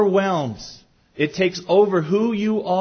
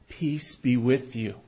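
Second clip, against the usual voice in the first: softer and slow, a calm blessing; gentle blessing; 5 semitones lower, 6 dB quieter, slower than usual. Retold or imitated. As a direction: offer it tenderly like a benediction. Quoting Christ's greeting.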